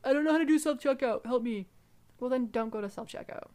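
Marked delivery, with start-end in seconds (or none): 0.1-1.7 higher, louder helpless shopper; helpless customer voice. Retold or imitated. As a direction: whine like a lost customer. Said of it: "sometimes people are like".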